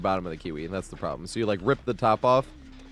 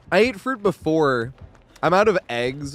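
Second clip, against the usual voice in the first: normal talking voice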